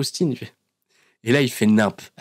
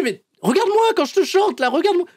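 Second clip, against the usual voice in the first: much higher, pushy mock plea; insistent Austin. Austin demanding Vince look at him, high-pitched. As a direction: demand eye contact in a squeaky high voice. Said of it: "Il lui dit".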